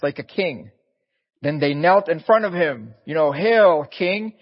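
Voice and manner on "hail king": sneering mock salute, pitched high and loud; mock hail. Soldiers kneeling before Jesus, taunting him with a loud sneering hail. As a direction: jeer it like a soldier bowing in scorn. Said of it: "but they mocked him".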